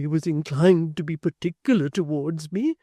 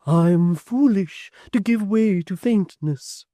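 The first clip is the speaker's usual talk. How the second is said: faltering; 7 semitones higher, 11 dB louder, slower than usual. A narrator's reading. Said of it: "Miss Tox faltered".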